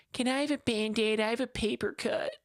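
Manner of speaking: high little schoolkid voice; young pupil. Retold or imitated. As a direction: imitate a pupil pleading to the teacher. Child pupil voiced by teacher.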